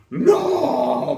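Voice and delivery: long, high mock cry of no; pained shout. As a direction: draw it out in a high wail. Acted pain as arm is yanked.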